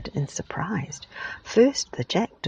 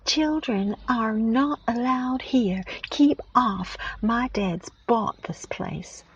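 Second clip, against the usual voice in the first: higher, slow, bossy yell; a higher, slow bratty yell to keep the children off; boy yelling loudly; 6 semitones higher, 4 dB louder, slower than usual. Acted. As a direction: shout them off the land like a spoilt boy. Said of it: "yelled loudly".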